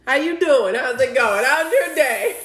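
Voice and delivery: high, loud singsong hello; big, put-on high-pitched small talk; over-eager greeting. Acting out an automatic chirpy greeting.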